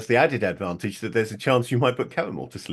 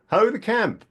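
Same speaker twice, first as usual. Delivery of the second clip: calling out; 12 semitones higher than usual. Acted. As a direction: call out to strangers at a distance. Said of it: "Caramel will call out".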